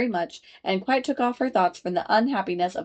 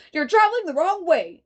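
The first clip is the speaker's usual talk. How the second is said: higher, louder verdict; guard; 9 semitones higher, 4 dB louder than usual. A narrator's reading. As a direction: announce it like an official. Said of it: "At last he said".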